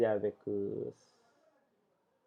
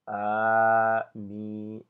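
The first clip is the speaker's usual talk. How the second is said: Amin held long on an even tone; long vowels; 4 dB louder than usual. Shown as a demonstration. Teacher repeating the long-vowel example, Amin stretched out. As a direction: sustain each vowel of Amin evenly. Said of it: "c'est la voyelle longue".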